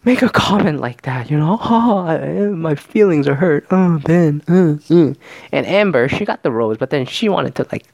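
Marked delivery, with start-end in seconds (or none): 0.0-5.1 mock wounded whining, higher-pitched; put-on pouty hurt voice, pitched up; pissed-off girls. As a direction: imitate upset girls wailing about hurt feelings. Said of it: "girls are fucking pissed".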